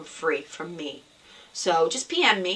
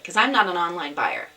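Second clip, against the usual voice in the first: usual speaking voice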